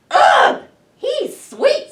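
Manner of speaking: put-on high, loud yuck of monster kids; disgusted little monsters. Little monsters squeal in disgust at the baby. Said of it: "said the little monsters".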